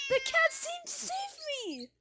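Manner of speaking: very high, loud, slow squeal; squealing delight. Real, not acted. Player gushing that the cat saved them, high squeal.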